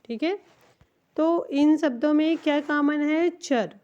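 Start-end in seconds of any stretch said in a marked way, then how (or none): 3.3-3.8 चर said as "char" with a short a; teaching pronunciation. Shown as a demonstration. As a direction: give the ending चर cleanly. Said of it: "इसे हम चर पढ़ते हैं". ("चर" को छोटे अ के साथ साफ़ बोलें)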